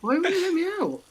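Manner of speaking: high frustrated yelp; loud, high frustrated cry. Real, not acted. Stuck in game, frustrated.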